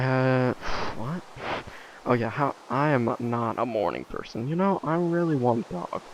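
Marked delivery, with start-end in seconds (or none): none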